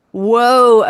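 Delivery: loud startled whoa. Retold or imitated. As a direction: blurt it out, caught off guard. Sudden fetal ejection reflex.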